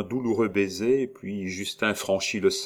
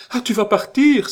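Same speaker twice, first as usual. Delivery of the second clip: much higher, louder terrified shriek; terrified cry; 10 dB louder than usual. A narrator's reading. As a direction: shriek in panic as he rises. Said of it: "s'écria la comtesse épouvantée". (cri épouvanté, bien plus aigu et fort)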